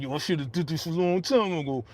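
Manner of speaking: lightly put-on angry character voice; Kanan. Host replaying the character's entrance line, lightly imitated. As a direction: slip into an imitation of a mad TV character. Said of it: "I'm trying to get my Kanan voice".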